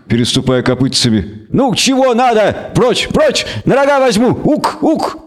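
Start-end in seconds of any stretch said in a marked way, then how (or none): 1.5-5.2 high bleating goat-man threats; goat creature voice. Acted.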